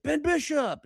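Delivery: high excited shout of recognition; excited call. Retold or imitated. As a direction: call his name out, thrilled. Drunk calling out to hockey player.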